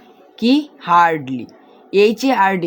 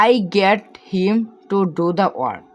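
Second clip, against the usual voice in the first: slow reading; much slower than usual. Shown as a demonstration. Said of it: "teacher reads model sentence slowly".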